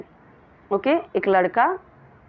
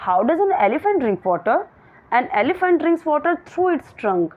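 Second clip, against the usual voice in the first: slow, clear classroom read; slow reading aloud; 5 semitones higher, much slower than usual. Shown as a demonstration. Teacher reading the question to her pupils slowly. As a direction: read each word out very slowly.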